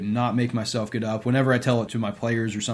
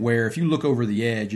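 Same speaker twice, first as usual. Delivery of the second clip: ordinary talk, nothing marked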